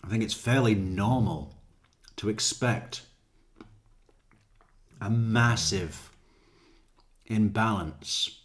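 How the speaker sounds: ordinary talk, nothing marked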